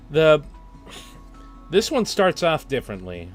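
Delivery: normal talking voice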